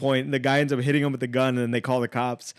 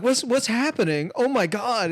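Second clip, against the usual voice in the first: high-pitched startled kid, lightly acted; mock alarm; 9 semitones higher than usual. Middle schoolers finding a robbery, mock alarm. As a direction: imitate kids stumbling onto trouble.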